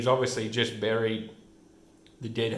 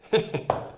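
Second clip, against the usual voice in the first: short high laugh; brief breathy giggle; amused chuckle; 8 semitones higher than usual. Real, not acted. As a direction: chuckle at a slapstick mishap. Amused by man stuck in window.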